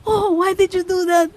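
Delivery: high-pitched mock dismay, imitating cousins; scolding cousins. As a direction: imitate relatives aghast at the waste. Acting out cousins' reaction. (ginagayang naninitang pinsan)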